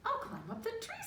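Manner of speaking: high little mouse voice. Acted. The mouse deciding to climb the tree, high and eager. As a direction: pipe it up like a tiny mouse. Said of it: "said Mortimer".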